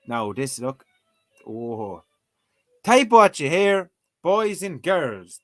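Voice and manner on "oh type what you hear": ordinary talk, nothing marked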